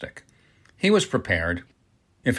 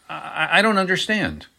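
higher-pitched, stammering confusion; flustered stammer; 5 semitones higher than usual. A narrator's reading. Flustered denial under questioning.

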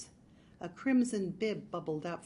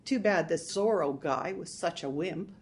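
ordinary delivery, nothing marked

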